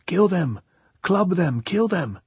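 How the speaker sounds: high, excited shouts, pitch climbing; high-pitched bloodthirsty yelling; drunkard shouting. A narrator's reading. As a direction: egg on a mob at the top of your voice. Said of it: "and then he shouted".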